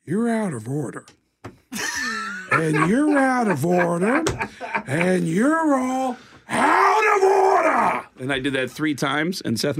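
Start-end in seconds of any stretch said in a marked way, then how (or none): 0.1-8.1 slow impression building to a huge high shout; Al Pacino. Slumlord among broken washers, escalating movie-star outrage. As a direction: start contained, escalate to drawn-out bellowing. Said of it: "as Al Pacino, surrounded by a bunch of broken down washers".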